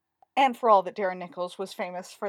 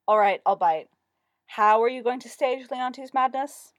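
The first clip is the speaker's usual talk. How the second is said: louder, reluctant question; resigned; 4 semitones higher, 8 dB louder than usual. A narrator's reading. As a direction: give in and ask.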